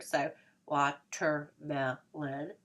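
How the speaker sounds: watermelon said very slowly with lips pressed shut; lips held together. Shown as a demonstration. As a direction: say watermelon with closed lips, syllable by syllable. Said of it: "hold the lips together".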